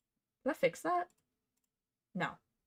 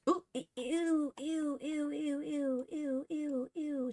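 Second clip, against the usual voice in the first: disgusted ews; 6 semitones higher, slower than usual. Real, not acted. Disgusted by a lumpy curve.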